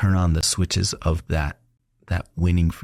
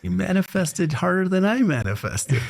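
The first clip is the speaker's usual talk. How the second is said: mock sore loser; 11 semitones higher than usual.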